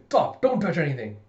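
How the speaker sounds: loud, sudden stop command; urgent warning. Acted. Father stops child at bookcase, alarmed. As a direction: cry out to halt a child.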